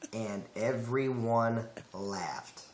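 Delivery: slow, careful, accentless diction; each word set apart, southern accent held back; deliberate neutral American speech; without an accent. Shown as a demonstration. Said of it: "say it without an accent".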